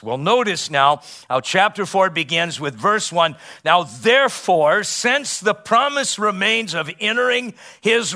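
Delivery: high, loud preaching cadence, hammering each point; full-force preaching. Acted. Preacher driving the verse home to his church, full-force preaching. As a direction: preach it to the congregation with rising fire. Preacher building through chapter four.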